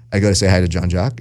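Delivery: ordinary delivery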